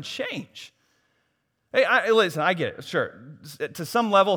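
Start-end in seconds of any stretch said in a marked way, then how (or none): none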